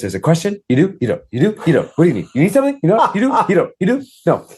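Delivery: a stressed host darting between guests; frazzled organizer running around; frantic event organizer. Retold or imitated. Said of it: "I was running around going".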